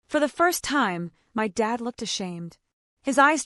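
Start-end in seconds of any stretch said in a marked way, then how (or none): none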